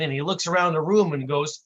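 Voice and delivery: usual speaking voice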